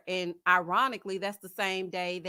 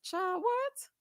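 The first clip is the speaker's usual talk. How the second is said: surprised; 11 semitones higher than usual. Real, not acted. Reacting to Joelle's claim.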